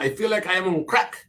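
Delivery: lightly put-on French accent. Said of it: "a French accent".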